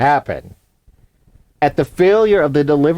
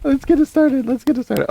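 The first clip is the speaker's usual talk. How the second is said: 1 higher echoed rally cry; 10 semitones higher than usual. Pretend.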